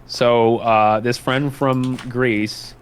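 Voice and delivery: ordinary talk, nothing marked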